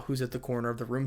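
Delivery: usual speaking voice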